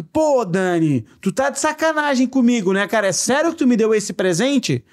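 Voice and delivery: thanking a friend for a surprise present, thrilled; happy disbelief. Shown as a demonstration. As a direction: show joy, not anger, in every word. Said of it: "eu não tava bravo que".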